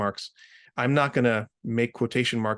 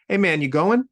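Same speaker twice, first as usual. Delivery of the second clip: upbeat casual; 7 semitones higher, 6 dB louder than usual. Acted. Sentence texts casually.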